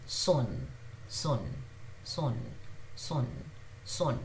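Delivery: son, s very soft; whisper-light s on son; soft s demo. Shown as a demonstration. Teacher drilling son for learners, soft. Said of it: "very softly it comes out son".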